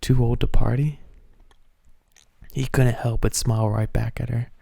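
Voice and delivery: ordinary delivery, nothing marked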